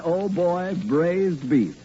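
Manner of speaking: usual speaking voice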